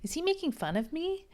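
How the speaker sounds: high, swooping incredulous inner voice; put-on heroine voice. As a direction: wonder it in a squeaky character voice. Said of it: "I don't know why I'm doing these voices".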